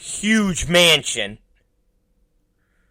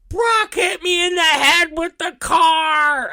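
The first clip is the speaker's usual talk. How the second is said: high, wailing mock cry of a hurt boy; crying kid tattling; 11 semitones higher than usual. Hurt son running to dad to tattle, high mock crying. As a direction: tattle through tears like a little kid.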